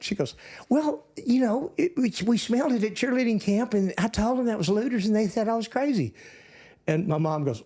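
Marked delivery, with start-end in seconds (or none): 0.7-6.1 put-on high Texan little-girl voice, explaining; little Texas accent. Little sister explaining camp to mom, high Texas accent. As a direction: imitate a kid sister's high-pitched Texas telling. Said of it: "with a little Texas accent".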